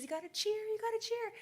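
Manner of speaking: high-pitched excited urging; urgent cheering on. Retold or imitated. Mom to daughters as the race starts, urgent cheering on.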